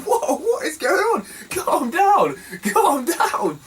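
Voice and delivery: high-pitched, drawn-out re-enacted shock; acted-out startled protest; alarmed disbelief. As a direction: cry out as if calming a mad attacker. Retelling own shocked reaction to attack.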